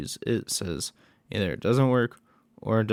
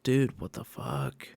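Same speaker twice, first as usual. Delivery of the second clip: lower, slowed-down mock bro voice; dude voice; 8 semitones lower, 4 dB quieter than usual. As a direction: play the baffled coworker dude. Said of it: "saying, like".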